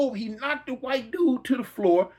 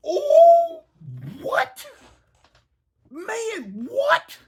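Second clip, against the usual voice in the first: high, laughing shocked what; laughing disbelief; 4 dB louder, much slower than usual. Real, not acted. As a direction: crack up in disbelief. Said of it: "I'm looking".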